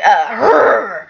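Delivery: imitated rough growl; tough-guy growl. Showing how she saw Rich, tough and rough, growling. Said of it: "really tough, rough, like".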